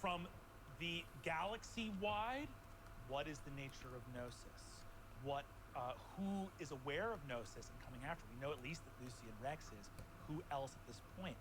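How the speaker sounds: low, measured storytelling; game master recap. Acted. GM recaps open questions.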